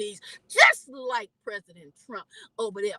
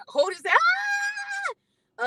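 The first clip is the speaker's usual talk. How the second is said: high, drawn-out alarmed cry; mother reliving the sight of the stick; shocked cry; much slower than usual. Retold or imitated. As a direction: gasp it out as if seeing the wound. Retelling son's pierced hand shock.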